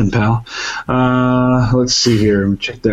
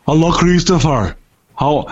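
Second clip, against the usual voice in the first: badly faked Austrian accent; Arnold Schwarzenegger; 3 semitones higher than usual. Shout-out to Christopher from the Governator, terrible Austrian accent. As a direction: attempt a hammy Austrian action-star accent. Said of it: "worst Schwarzenegger accent ever".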